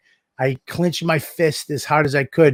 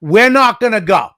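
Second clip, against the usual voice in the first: raised voice, higher and louder; sharp parent yell; retold yell; 5 semitones higher, 5 dB louder than usual. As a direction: yell at the kid to stop. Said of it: "I yelled".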